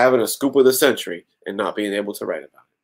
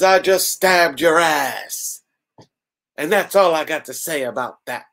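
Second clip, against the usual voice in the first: Stone Cold; 6 semitones higher than usual. Acted. Lois boasting she stabbed her, Stone Cold parody. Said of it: "Stone cold voice, get it?".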